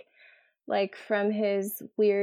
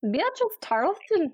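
mock Irish accent; 9 semitones higher than usual. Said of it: "I can't even try".

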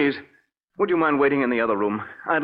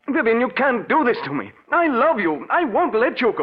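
higher, urgent cry of a man losing his wife; desperate pleading; 10 semitones higher than usual. Acted. Husband crying out to his leaving wife, higher and desperate. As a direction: beg her, voice climbing in panic.

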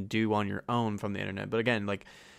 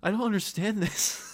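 high-pitched mimicry of a lost mother; bewildered mom; 10 semitones higher than usual. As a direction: imitate a parent helplessly confused. Acts out mom's bewildered reply.

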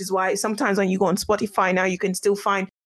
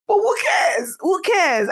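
high, loud who cares; joyful shout; 7 semitones higher, 4 dB louder, slower than usual. Real, not acted. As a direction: cry it out in happy defiance. Joyful outburst about downloads.

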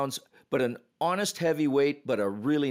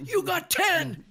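high triumphant call; slower than usual. Retold or imitated. Referee hands out misconduct.

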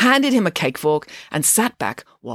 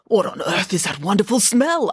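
higher, wonderstruck question; amazed king; 4 semitones higher than usual. A narrator's reading. The king smells the cake from the box, amazed. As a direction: marvel at it as an astonished king.

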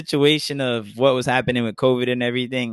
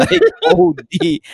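high, slow laughing exclamation; 5 dB louder, slower than usual. Real, not acted. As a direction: crack up saying it. Laughing at the fake picture.

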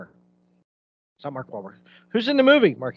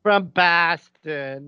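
very slow Boston drawl; Boston accent; much slower than usual. Retold or imitated. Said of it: "immediately go".

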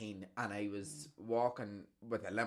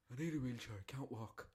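imitating granda; 9 dB quieter than usual. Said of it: "the first thing he did was".

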